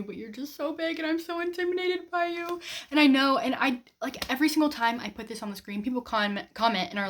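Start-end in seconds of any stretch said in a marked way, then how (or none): 0.0-2.6 high, pouting voice, like scolding a big book; mock-whiny talking to a book. Vlogger tells her huge book she's intimidated, high mock whine. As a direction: whine at it, overwhelmed but playful. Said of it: "I'm like".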